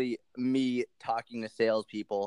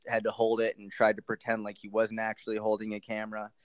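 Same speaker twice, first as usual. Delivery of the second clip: usual speaking voice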